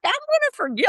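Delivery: high-pitched mock disbelief; acted shock, voice jumping up high; incredulous. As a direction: squeal out the outrage, way up high. Incredulous retort to Bob.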